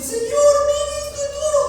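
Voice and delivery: high, loud plea to God; anguished believer begging God; pleading complaint. Pretend. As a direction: cry out to the Lord that it is hard.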